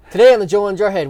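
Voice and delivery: show host intro. Acted.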